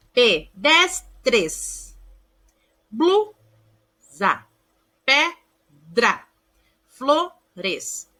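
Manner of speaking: Pedestres, Blusa, Pedra, Flores, each broken into separate syllables. Shown as a demonstration. Teacher showing pupils consonant clusters, syllable by syllable.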